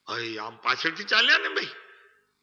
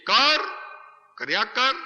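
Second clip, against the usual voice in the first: higher, louder push to keep doing; mock urging shout; 9 semitones higher, 5 dB louder, slower than usual. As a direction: egg him on, louder. Preacher urges listener to keep trying. (ઊંચા, જોરદાર અવાજે ઉશ્કેરતા)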